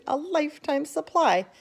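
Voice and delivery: envious. A narrator's reading. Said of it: "another boy said enviously".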